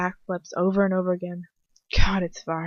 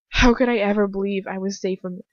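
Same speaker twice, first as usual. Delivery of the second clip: ordinary delivery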